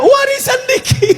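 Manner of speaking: very high comic wail; hammed-up tearful whine; mock crying. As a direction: sob it out as a fake-weeping widow. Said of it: "Ibu nangis kenapa?".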